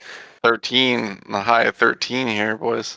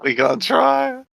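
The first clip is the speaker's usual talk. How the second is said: higher and slower squeak; higher squeaky voice; 4 dB louder, slower than usual. Pretend. Player repeats the line.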